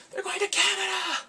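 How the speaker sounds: high, squealed announcement; hammed-up, high-pitched thrill; mock excited exclamation. Mock amazement at drama plot.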